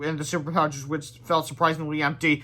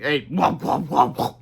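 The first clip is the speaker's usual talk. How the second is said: ordinary delivery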